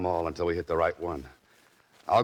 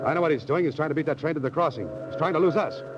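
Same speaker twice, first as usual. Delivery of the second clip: raised, urgent and quick; urgent chase; 9 semitones higher than usual. Acted. Chasing Bolton's car toward the crossing, urgent raised voice. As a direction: call it out as the car races the train.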